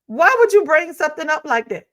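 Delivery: acted-out indignant question; indignant challenge. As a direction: challenge it like someone taken aback. Voices an offended person's challenge.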